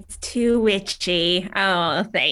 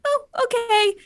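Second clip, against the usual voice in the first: underwhelmed okay; 13 semitones higher than usual. Retold or imitated. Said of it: "I was sort of like".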